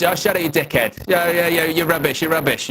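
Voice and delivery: lightly hammed-up friend shouting insults; mocking Joe's rant. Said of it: "He goes".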